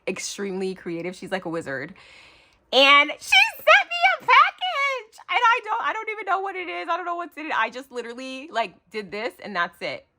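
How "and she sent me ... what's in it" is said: high, giddy rush about a package; excited. Real, not acted. Unboxing vlogger to viewers, high and giddy. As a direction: bubble over with excitement.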